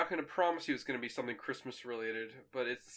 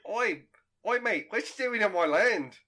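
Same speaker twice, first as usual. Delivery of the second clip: loud, higher-pitched Aussie bloke; 7 semitones higher, 7 dB louder than usual. Acted. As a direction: ham up a broad Australian challenge. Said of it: "very stereotypical Australian voice".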